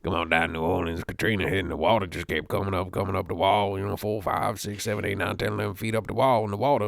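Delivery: imitated New Orleans local recounting the flood; New Orleans documentary voice. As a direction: put on a New Orleans storyteller's accent. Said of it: "couldn't stop doing that voice".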